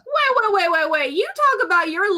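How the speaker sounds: urgent interruption. Real, not acted. Player interrupts in protest.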